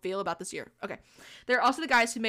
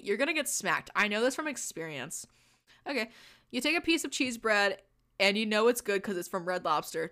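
ordinary delivery

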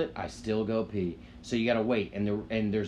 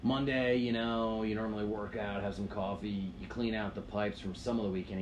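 imitating dad; 4 semitones lower, 6 dB quieter than usual. Dad explaining Tuesdays to his son, low soft wise voice. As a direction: lay it out calmly like a wise dad. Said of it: "He goes".

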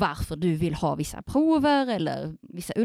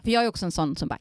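ordinary talk

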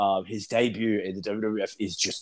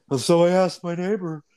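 Terry Funk; 6 semitones higher, 4 dB louder than usual. Retold or imitated. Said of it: "and he goes".

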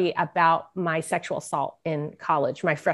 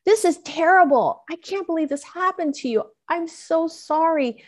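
high, pleading voice, retold; upset young son; 9 semitones higher than usual. Youngest son to mom after her story, retold upset. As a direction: voice a distressed child, pitched well up. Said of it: "he started crying".

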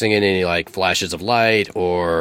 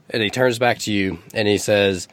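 normal talking voice

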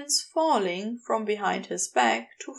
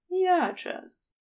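plain reading voice

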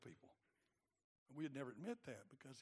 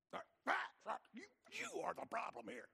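mock accusation; 4 dB louder than usual.

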